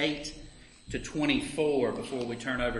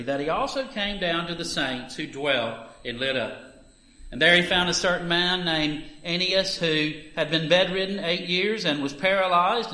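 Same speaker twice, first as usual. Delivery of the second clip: raised, earnest Bible reading voice; scripture reading; 4 semitones higher, 8 dB louder than usual. Acted. Reader giving the morning lesson to the congregation, earnest scripture reading. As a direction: read the passage out like from the pulpit. Reads Acts nine aloud.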